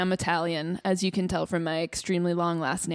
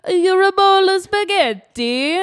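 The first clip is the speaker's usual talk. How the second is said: slow, much higher and louder, sing-song endearment; doting Italian man; 11 semitones higher, 10 dB louder than usual. Pretend. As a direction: gush over them like a doting Italian. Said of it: "if someone's like".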